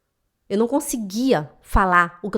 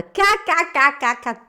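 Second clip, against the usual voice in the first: higher-pitched staccato mock cackle; imitated guffaw; 4 semitones higher than usual. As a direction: fake a boss's scornful belly laugh. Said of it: "Ele deu uma gargalhada". (gargalhada debochada imitada)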